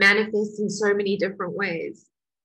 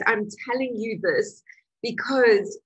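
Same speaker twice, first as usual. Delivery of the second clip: ordinary talk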